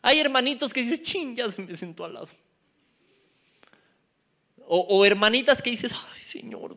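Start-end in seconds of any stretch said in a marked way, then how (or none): 1.0-2.3 soft aside to yourself; hushed mock complaint; private grumble. Acted private grumble about neighbour. 5.9-6.8 breath-only mock prayer; hushed, exasperated appeal, acted out; whispered plea.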